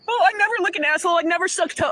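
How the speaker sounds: high, quick mock denial; mocking imitation. Woman mimicking her ex's prim denials, mocking. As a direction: mimic him in a silly high voice.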